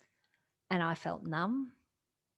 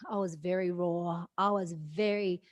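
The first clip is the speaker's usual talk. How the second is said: normal talking voice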